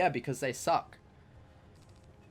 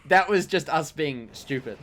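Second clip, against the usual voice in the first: loud, higher-pitched denial; loud protest; 7 semitones higher, 10 dB louder than usual. Real, not acted. Friend denying having clowned the other, loud protest. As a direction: talk over them in loud protest. Heated protest against being accused.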